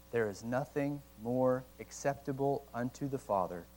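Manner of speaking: slow, hushed reading, fading at the end; unhurried and soft, let it trail away; reading slowly. Real, not acted. Pastor closing the sermon with Owen, slow and fading. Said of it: "I have to read it slow".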